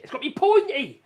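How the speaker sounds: "pointy" yelled high and loud in a film-character rant; hammed-up loud insistence on "pointy"; mock shout. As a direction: bark out "It's gotta be pointy!" like the movie dictator. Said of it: "Sacha Baron, whatever, he goes".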